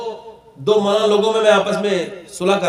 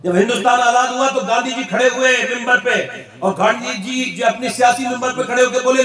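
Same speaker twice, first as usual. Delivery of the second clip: normal talking voice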